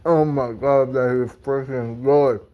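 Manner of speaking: talking through a mouthful of food; words round a big bite; mouth full. Real, not acted. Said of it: "Sorry about talking with my mouth full".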